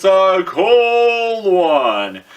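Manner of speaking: drawn-out. Acted. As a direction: stretch it out dramatically. Stretched dramatic phrase.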